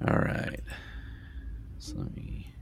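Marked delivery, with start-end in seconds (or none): 1.8-2.5 whispered aside; whispering. Real, not acted. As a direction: breathe it out, unvoiced. Game master aside.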